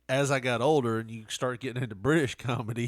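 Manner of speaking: normal talking voice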